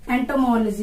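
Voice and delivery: एंटोमोलॉजी as ento-MOL-jee; corrected pronunciation. Shown as a demonstration. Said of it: "यहाँ तक याद रखो". (एंटोमोलॉजी को एंटोमोल-जी बोलकर)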